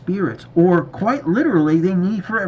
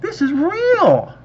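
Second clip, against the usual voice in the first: slow, drawn-out awe, rising much higher on the second half; stunned disbelief; 8 semitones higher, 3 dB louder, much slower than usual. Retold or imitated. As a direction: let it dawn on you slowly, then go up high in wonder.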